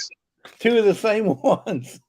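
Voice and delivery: slow, high, laughing words. Real, not acted. Laughing over the duplicate items sent.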